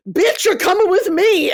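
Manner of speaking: high, loud commanding claim; hammed-up possessive order; Cora's demand. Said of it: "storms in and is like".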